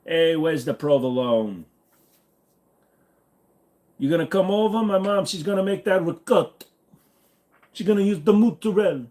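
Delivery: high, hammed-up Italian-American accent; mock someone overdoing an Italian-American accent on food words; fake Italian-American accent. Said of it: "They're not Italian at all".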